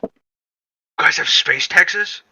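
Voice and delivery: can't believe what you heard; amazed disbelief. Real, not acted. Player amazed.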